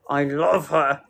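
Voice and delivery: low, drawn-down repeat; insistent reassurance. Real, not acted.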